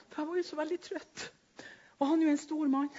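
ordinary talk, nothing marked

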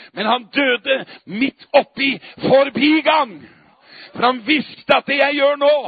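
fiery Easter preaching, building to a roar; preaching at full force. Acted.

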